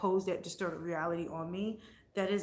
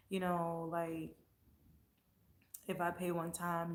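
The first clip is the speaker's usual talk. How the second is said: ordinary talk, nothing marked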